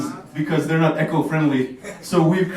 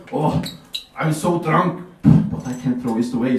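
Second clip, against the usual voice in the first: lightly played drunk; a hint of a tipsy Swede; 4 semitones lower than usual. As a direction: mildly imitate a conscientious drunk. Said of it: "Even drunk Swedes are like".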